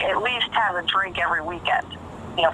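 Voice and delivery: normal talking voice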